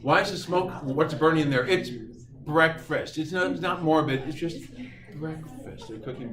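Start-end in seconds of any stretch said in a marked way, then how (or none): none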